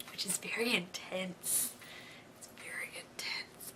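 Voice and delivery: hushed, dramatic whisper. Real, not acted. Fan whispering to viewers how intense the wait is. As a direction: lean in and whisper it.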